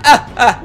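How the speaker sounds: hammed-up villain cackle; gloating mustache-twirling laugh; evil laugh. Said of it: "Mira mi risa malvada".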